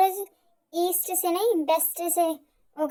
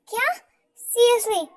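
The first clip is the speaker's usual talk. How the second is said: high, louder shocked disbelief; 6 semitones higher, 4 dB louder, much slower than usual. Acted. Girlfriend reacting to his confession, high and loud. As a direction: recoil at the news.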